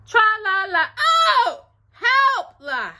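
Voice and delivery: high, loud strained tra-la-la with a yelp; effortful squeal mid-song; straining to reach. A narrator's reading. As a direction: sing it while stretching, breaking into a cry. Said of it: "as he tried to reach his toes".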